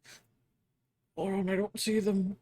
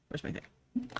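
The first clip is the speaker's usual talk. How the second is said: whispering; 6 semitones higher, 11 dB quieter, slower than usual. Real, not acted. Muttering to self.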